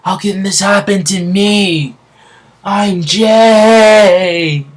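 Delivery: very high, drawn-out acted yell; Jay yelling. Falling villain crying out at his defeat, high drawn-out yell. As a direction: wail it out like a villain plummeting. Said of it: "He's yelling".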